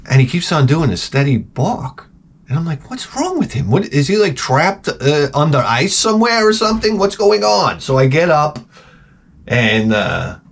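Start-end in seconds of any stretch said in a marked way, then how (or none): 2.9-7.8 tag. Acted. Acted bewilderment at barking dog.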